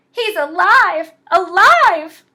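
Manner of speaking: high, loud thrilled cry of alive; excited exclaim. Acted. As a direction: shout it like kids seeing a miracle. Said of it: "the little terrors exclaimed".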